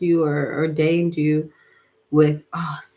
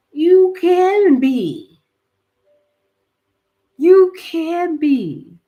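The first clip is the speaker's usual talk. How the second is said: loud, drawn-out, raised-pitch cheer; slow belted encouragement; 9 semitones higher, 6 dB louder, much slower than usual. Real, not acted. Telling dreamers they can be Grammy artists, belting. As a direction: belt it out slowly to lift them up.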